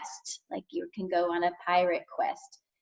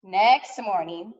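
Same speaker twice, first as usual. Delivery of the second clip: ordinary delivery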